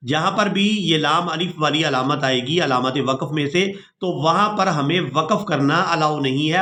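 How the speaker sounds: normal talking voice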